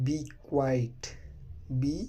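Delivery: ordinary delivery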